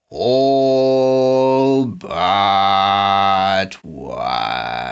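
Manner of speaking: sinking to a low menacing growl. A narrator's reading. Snatcher eyes Eggs in his cage, growling. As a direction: drop the last word low and cold. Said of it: "Snatcher growled".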